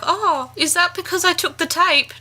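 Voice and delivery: high, faux-innocent question; hammed-up ditzy innocence; mock innocent Audrey. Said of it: "is like".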